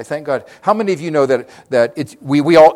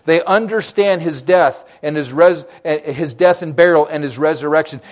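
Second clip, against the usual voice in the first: ordinary talk, nothing marked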